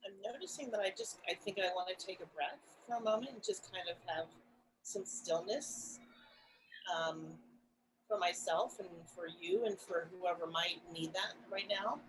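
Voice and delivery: very soft, gentle check-in; hushed and reflective, with pauses. Real, not acted. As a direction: speak very quietly, making room for stillness. Pausing for breath and stillness.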